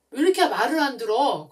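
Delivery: put-on exasperated mother telling off her child; loud scolding. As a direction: scold a child who won't listen. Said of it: "계속 꾸짖게 돼요".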